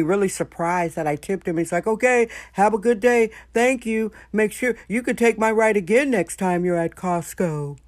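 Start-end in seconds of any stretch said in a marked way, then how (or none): 1.9-7.8 imitating the cheerful driver. Voicing surprised driver thanking her.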